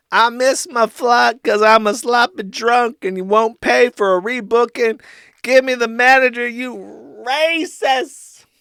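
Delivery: mock him as a whining sloppy drunk; mocking Ralph. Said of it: "This is me making fun of him".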